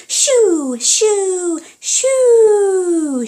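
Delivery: very loud, high, drawn-out shouts; shouting. Acted. Angelina scaring Big Cat away, long loud shout. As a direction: chase the cat off with all your might. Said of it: "she shouted".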